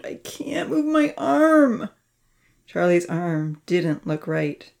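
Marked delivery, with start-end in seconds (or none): 0.0-1.9 slow, high-pitched moan; moaning in pain. A narrator's reading. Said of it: "Charlie moaned".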